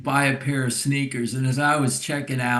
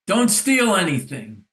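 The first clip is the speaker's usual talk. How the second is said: mock yell, higher pitched; retold yell; 6 semitones higher, 4 dB louder than usual. Old man warning the girl walking in, retold yell. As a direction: imitate a storekeeper's shouted warning, not full force. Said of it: "coming in to get yelled at".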